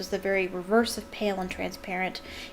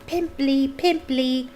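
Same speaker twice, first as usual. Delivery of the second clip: higher, mildly shouted jeer; taunting yell; 5 semitones higher, 3 dB louder, slower than usual. A narrator's reading. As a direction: chant it after someone fleeing.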